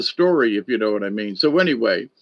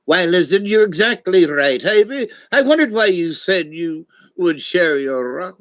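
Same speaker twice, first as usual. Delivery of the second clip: surprised snake; 3 semitones higher than usual. Acted. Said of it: "Snake was taken aback".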